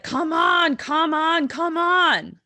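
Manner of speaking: high, loud, insistent mock nagging; impatient urging. Twin nagging Merritt to leave her chair, loud pleading. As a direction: push each repeat out loud and high.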